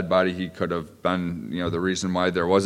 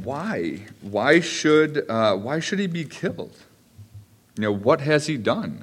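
higher, imploring why; pleading son; 6 semitones higher, slower than usual. Acted. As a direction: beg the king to see reason. Jonathan pleading with angry father.

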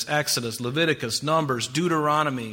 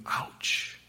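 whispered ouch; 9 dB quieter than usual. Acted. Reacting to Jesus' rebuke.

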